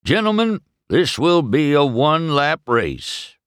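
loud, high-pitched sheriff's announcement; sheriff announcing. A narrator's reading. Sheriff addressing the town before the race, announcing loudly. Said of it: "Sheriff announced".

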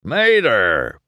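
loud, stern sheriff's shout; angry authority calling out; bellow. A narrator's reading. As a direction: bellow the name to stop someone short. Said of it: "bellowed the sheriff".

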